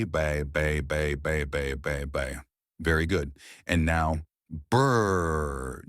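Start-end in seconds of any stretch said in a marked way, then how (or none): 0.0-2.4 bouncy repeated bay, fading out; lip warm-up bay. Shown as a demonstration. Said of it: "warm up our lips". 4.5-5.8 lips buzzing brr; lip trill. Shown as a demonstration. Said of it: "just like when you were a kid".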